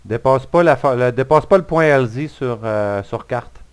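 appel crié à pleine voix; crié fort. Real, not acted. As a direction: crier les ordres à l'équipe. Ordres criés à l'équipe. (ordres criés sur le terrain)

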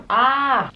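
sharp yelp at a burning-hot bite; a quick high startled cry from heat; pained gasp. Real, not acted. Vlogger burning her mouth on a hot pasty, sharp yelp. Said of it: "That is hot".

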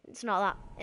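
usual speaking voice

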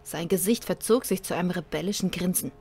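dramatic narrator reading a sci-fi tale; story narration. A narrator's reading. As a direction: read it like an audiobook.

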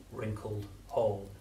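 usual speaking voice

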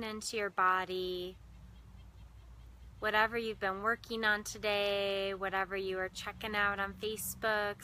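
measured meditation voice with long pauses; guided meditation. Real, not acted. Meditation host guiding listeners into breath and heart, unhurried guided meditation. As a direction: guide listeners inward, lingering on key words and leaving silences.

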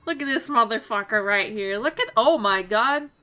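ranting loudly; loud exasperated rant. Real, not acted. Ranting about enemy.

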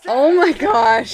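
surprised. Real, not acted. Reacting to the kiss chant.

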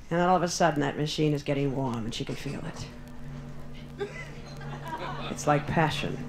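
low, breathy-quiet teasing build; mock sensual hush. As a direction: hush it like a love scene. Mock-sensual slot machine scene.

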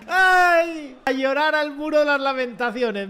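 high, loud mocking jeer; taunting. Real, not acted. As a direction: send them off crying. Taunting opponents. (burla, aguda y fuerte)

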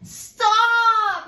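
big loud booming stop; gorilla's yell. A narrator's reading. Gorilla yelling at the fleeing mouse. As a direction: bellow it to halt her. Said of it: "yelled the gorilla".

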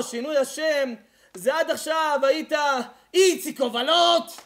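preacher lecturing the crowd loudly; fiery preaching. Acted. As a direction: drive the teaching home. Preaching at full force.

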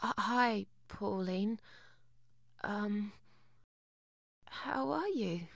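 soft, halting, embarrassed greeting; awkward hesitation. Acted. Reluctant visitor caught by an acquaintance at the door, awkward and quiet. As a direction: greet them quietly, stalling between words.

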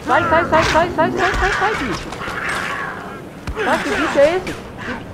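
high panicked screams; frantic, shrieking get away; being chased. Real, not acted. Surpreendido por bicho, foge gritando. (gritos agudos de pânico)

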